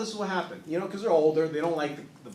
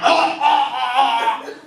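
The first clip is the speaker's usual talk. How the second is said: mock gagging; 6 semitones higher, 9 dB louder, much slower than usual. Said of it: "I'm, like, gagging".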